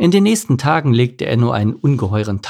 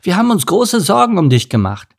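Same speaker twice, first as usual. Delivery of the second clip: reproachful, higher-pitched worried parents; parents' scolding; 8 semitones higher, 4 dB louder than usual. A narrator's reading. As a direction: scold a child with mild reproach. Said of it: "eine ordentliche Standpauke seiner Eltern".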